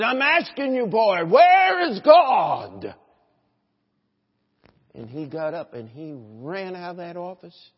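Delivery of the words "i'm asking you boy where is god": very loud, high, drawn-out shout; thundering demand. Acted. Old preacher pressing a wide-eyed little boy, thundering demand. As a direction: thunder it, stretching the final words.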